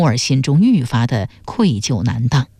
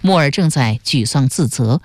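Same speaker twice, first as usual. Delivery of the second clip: usual speaking voice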